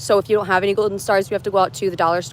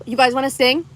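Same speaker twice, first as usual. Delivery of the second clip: ordinary talk, nothing marked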